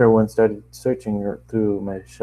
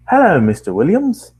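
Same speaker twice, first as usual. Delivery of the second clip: desk clerk greeting, pitched high and loud; changed front-desk voice; 8 semitones higher, 6 dB louder than usual. Acted. Man at the front desk greets the tenant, put-on higher voice. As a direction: call out the greeting in a higher, swooping voice. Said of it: "I'm gonna try something else".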